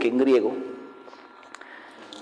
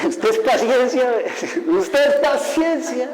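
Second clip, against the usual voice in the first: high, laughing mockery of a doubter; mocking laughter; 9 semitones higher, slower than usual. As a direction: scoff at him, laughing it off. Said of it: "Al principio se reían".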